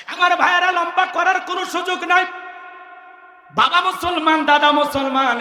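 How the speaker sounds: impassioned, high-pitched sermon oratory; forceful sermon delivery; fiery preaching. Real, not acted. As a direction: preach it out to rouse the crowd.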